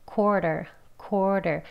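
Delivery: "quarter" short, the first r barely pulled; weakened first R. Shown as a demonstration. Teacher showing the natural way, "quarter" with weak first r. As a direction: say "quarter" lightly, softening the r before the tap. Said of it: "약하게 해요".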